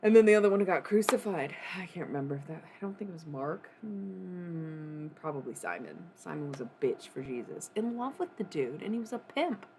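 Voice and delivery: ordinary talk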